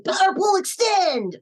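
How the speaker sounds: high, loud, drawn-out attack call; Goku battle cry. Shown as a demonstration. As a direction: call it out like an anime hero powering up. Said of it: "favorite line that you've said as an anime character".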